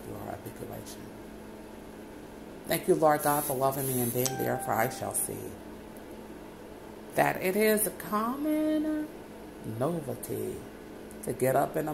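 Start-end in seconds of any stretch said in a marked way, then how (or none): none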